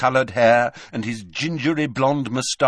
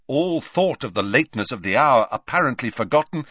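usual speaking voice

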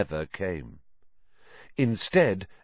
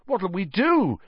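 aghast; 8 semitones higher than usual. Acted. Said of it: "said Ron, aghast".

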